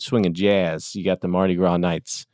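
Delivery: ordinary talk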